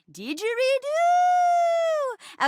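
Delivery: DIDGERIDOO stretched long and high; loud, high, silly drawn-out word; playful relish. Acted. Actor trying out a silly safe word, playful relish.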